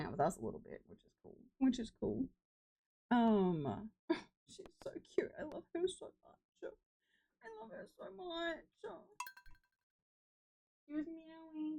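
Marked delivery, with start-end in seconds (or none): 4.5-11.8 soft, high, breathy adoration; hushed gushing. Real, not acted. Streamer quietly gushes over her cat, high and soft. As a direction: gush about her in a hush.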